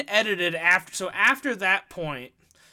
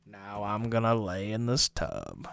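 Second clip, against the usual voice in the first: Morgan Freeman; 7 semitones lower, 7 dB quieter than usual. Acted. Co-host joking about bathing in a tub, bad impression. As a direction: badly imitate a famous actor's low voice. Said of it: "our bad Morgan Freeman impressions".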